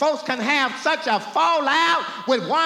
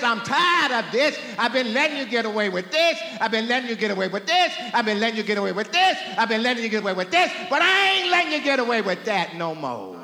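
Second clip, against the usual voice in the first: repeats rushed faster and faster; God fed up, rapid-fire; much faster than usual. Acted. God scolding his people, rushing the repeated line. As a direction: rattle it off, speeding up each time. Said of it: "So God says".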